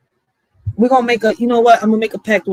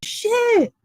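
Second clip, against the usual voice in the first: very high, rising astonished squeal; high squeaky disbelief; mock shock; 12 semitones higher, 4 dB quieter than usual. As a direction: act totally floored.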